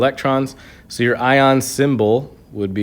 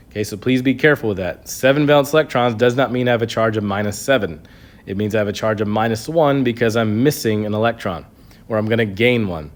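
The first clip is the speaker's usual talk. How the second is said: usual speaking voice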